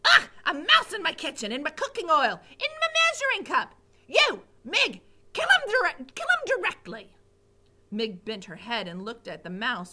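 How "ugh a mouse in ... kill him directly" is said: high, loud shrieking orders; panicked cook yelling. Acted. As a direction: hysterical over a mouse in the oil. Cook panics over mouse.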